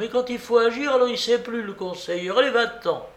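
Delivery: put-on high, scolding old-lady voice; impatient babouchka. A narrator's reading. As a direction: scold him sharply and dismiss him. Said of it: "La babouchka perdit patience". (vieille dame exaspérée)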